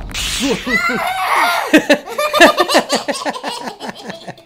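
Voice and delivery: a big whooping laugh. Real, not acted. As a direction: burst out laughing, high. Laughing after the slip.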